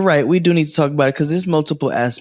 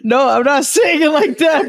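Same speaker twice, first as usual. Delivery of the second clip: laughing protest; 13 semitones higher, 4 dB louder than usual. Real, not acted.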